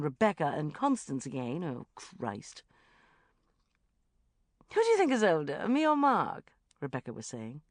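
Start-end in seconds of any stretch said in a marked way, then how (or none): 4.7-6.5 put-on high, loud, cooing to a child; playful coaxing. A narrator's reading. Rebecca quizzing the child about ages, playfully. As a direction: quiz a little girl coyly. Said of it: "Rebecca went on playfully".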